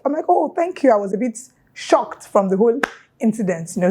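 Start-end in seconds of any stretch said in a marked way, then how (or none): 0.3-0.9 surprised thanks. Retold or imitated.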